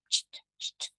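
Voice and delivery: unvoiced tch, tch, tch; the "tch" sound at the end of changed. Shown as a demonstration. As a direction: breathe out "tch" with no voice. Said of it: "E aí eu vou fazer tch".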